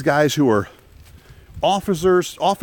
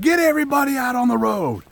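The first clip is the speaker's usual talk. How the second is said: high mock drill-sergeant yell; instructors screaming; 7 semitones higher than usual. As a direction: bark it like a maniac instructor. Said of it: "They're screaming like maniacs".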